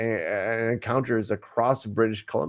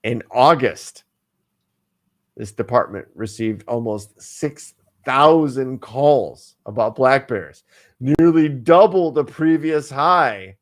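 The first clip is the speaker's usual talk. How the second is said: slow, loud, stressed repeat; emphatic repeat; 7 dB louder than usual. Shown as a demonstration. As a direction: hammer each fact home slowly. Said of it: "Let me repeat".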